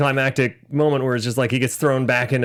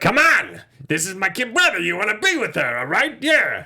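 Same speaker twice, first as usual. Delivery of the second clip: a hint of a brash New York brother; lightly voiced pushy big brother; Bob Hoskins impression; 6 semitones higher than usual. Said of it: "Bob Hoskins is the more like".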